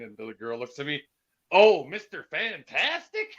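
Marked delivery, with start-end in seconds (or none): 1.5-3.3 high, drawn-out, louder imitation; imitating someone tickled to recognise him; mock delighted recognition. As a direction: play up the amazed recognition. Girl recognises him, acted out.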